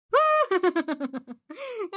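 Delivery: bitter laugh. Acted. Bitter laugh amid grief.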